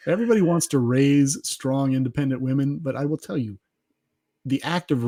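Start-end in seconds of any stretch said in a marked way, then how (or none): none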